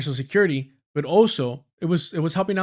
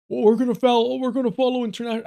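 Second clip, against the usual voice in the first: higher, fast, put-on voice; mocking Mamdani impression; 8 semitones higher, faster than usual. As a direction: mimic him with a quick nasal parody. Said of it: "I don't even know what accent I should give Mamdani".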